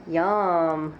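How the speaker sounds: ordinary talk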